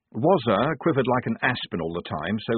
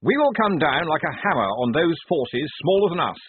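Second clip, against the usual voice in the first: the lieutenant, cheerful and much higher; relishing his grand plan; eager officer, happily announcing the plan; 8 semitones higher, 4 dB louder than usual. A narrator's reading. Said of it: "said Blouse happily".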